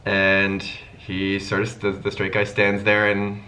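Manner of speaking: usual speaking voice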